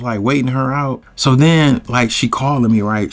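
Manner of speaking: usual speaking voice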